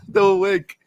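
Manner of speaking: laughing delight. Real, not acted. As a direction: lose it over the wink. Reacting to the wink again.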